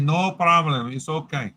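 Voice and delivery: put-on light Scottish accent, easygoing. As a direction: quote him in a Scottish accent. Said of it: "how was my Scottish accent?".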